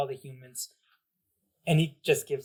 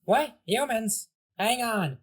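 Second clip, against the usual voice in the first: kitty voice; 8 semitones higher, 3 dB louder, slower than usual. Acted. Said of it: "The two bit too high".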